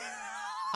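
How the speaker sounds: high-pitched giggle; real laugh. Real, not acted. Storyteller laughing at his own gag, high laugh. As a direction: burst of amused laughter.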